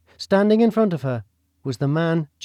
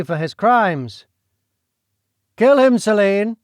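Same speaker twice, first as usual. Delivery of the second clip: a menacing nobleman, pitched up and pressing hard; scheming duke; 5 semitones higher, 5 dB louder than usual. Acted. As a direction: lean in and push her to the deed. Duke voiced urging Celine to kill.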